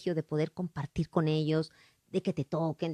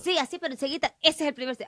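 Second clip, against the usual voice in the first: high, eager outburst; eager mock announcement; excited blurt; 7 semitones higher, 3 dB louder than usual. Retelling eager first-single claim.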